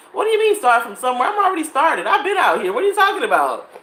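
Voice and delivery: indignant retort. Retold or imitated. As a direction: fire back offended. Retorting to boyfriend.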